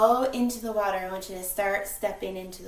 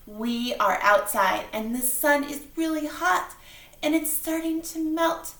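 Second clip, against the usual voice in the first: ordinary delivery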